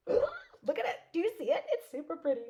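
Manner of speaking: gasp, then high squealing delight; excited squeal. Real, not acted. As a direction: squeal over the pretty card. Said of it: "you could hear me squealing".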